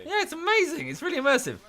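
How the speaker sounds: very high-pitched mock enthusiasm; gushing praise. Player praises teammate's French role playing, high gushing delivery. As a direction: gush over the performance.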